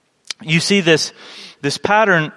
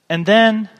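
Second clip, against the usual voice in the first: ordinary delivery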